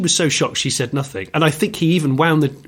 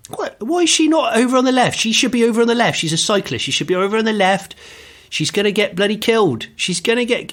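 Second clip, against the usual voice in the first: much higher and fast, indignant imitation; exasperated instructor; 9 semitones higher than usual. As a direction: complain at the cyclist in the road, outraged. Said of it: "And he'd go".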